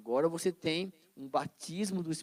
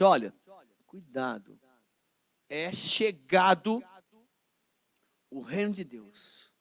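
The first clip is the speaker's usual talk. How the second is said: solemn announcement; 2 semitones lower, 3 dB louder, slower than usual. Acted. Jesus quoted announcing kingdom.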